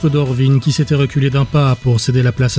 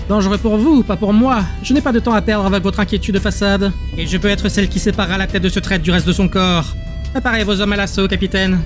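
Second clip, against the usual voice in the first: higher-pitched, brusque rebuff to a subordinate; commanding counsellor; 6 semitones higher than usual. Acted. Counsellor tells her captain to prepare the assault, commanding. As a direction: voice the counsellor dismissing his worry and giving orders.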